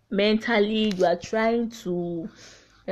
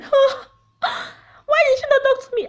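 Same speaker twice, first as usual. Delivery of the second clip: much higher, fast, hammed-up wail; mock wailing mourner; 6 dB louder than usual. Mocking mourners at her grave who never checked on her, higher and wailing. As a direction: overplay a fake mourner crying at the grave.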